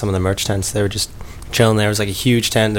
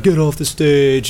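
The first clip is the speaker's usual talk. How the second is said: drawn-out, slightly higher heckling call; mock heckle; 4 semitones higher, slower than usual. Quoting impatient crowd at the DJ, mock drawn-out heckle. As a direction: imitate a crowd calling out, stretched slowly.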